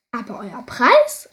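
loud call, slowed. Acted. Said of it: "rief der König".

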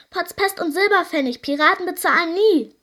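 loud, high pirate outburst; indignant pirates. A narrator's reading. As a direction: thunder a refusal. Pirates refuse to pay. (lautstark empörte Piraten)

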